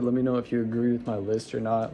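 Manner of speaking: ordinary talk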